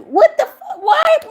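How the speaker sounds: high, loud, disbelieving yell; outraged shout. Real, not acted. Vlogger shouting in disbelief at the texts, high and loud. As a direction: cry out in real outrage at what you just read.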